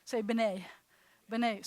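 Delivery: "B'nai" said as buh-NAI with a tiny "uh" after B; B'nai with schwa. Shown as a demonstration. Teacher having students say the congregation's name with the schwa. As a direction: slip a short schwa between B and N. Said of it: "Say it".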